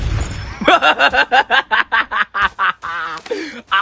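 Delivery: long, drawn-out villain laugh; long gloating cackle. Real, not acted. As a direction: cackle slowly in triumph. Gloats after winning.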